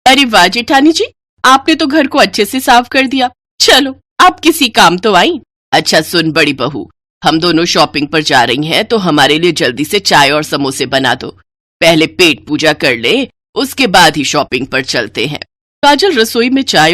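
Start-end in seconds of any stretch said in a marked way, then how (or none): 0.1-5.4 higher, mocking praise; sarcastic sister-in-law, pitched higher; taunting younger bride. 5.7-15.4 put-on lower, commanding old woman; lower, curt mother-in-law voice; bossy mother-in-law. Mother-in-law orders elder bride.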